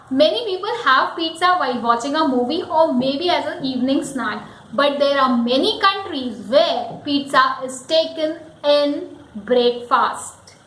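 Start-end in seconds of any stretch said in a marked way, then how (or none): none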